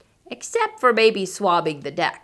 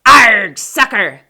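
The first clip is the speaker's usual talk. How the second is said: loud gloating Arg; loud taunting pirate captain; pirate yell; 11 dB louder, slower than usual. Acted. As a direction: shout it as he kicks the ball.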